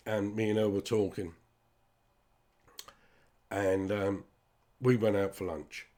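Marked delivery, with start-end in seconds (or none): none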